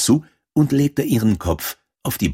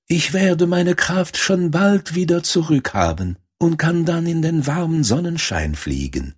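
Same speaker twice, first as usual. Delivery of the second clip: high-pitched little bird voice; sick swallow; 9 semitones higher than usual. A narrator's reading. Sick swallow promises Däumelinchen it will recover, in a high voice. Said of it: "sagte die kranke Schwalbe".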